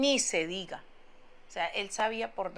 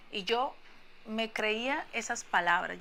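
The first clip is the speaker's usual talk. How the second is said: normal talking voice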